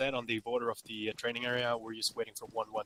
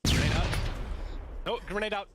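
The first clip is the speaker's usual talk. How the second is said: shouted callout; 5 semitones higher, 5 dB louder than usual. Real, not acted. Calling grenade in fight.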